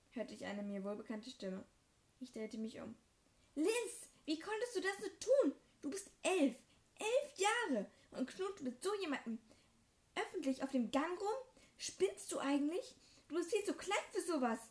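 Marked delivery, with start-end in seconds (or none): 3.5-14.6 high, loud scolding brother; furious yelling. Acted. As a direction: yell it red-faced at your little sister. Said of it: "der das alles mit Hochrot im Kopf schrie".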